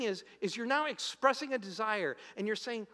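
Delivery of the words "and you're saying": ordinary talk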